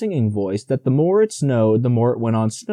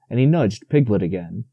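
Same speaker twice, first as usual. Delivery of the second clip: usual speaking voice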